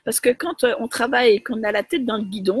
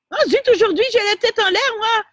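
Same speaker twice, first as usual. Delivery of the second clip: exasperated at herself; 10 semitones higher, 4 dB louder, faster than usual. Real, not acted. Forgets name, annoyed at self.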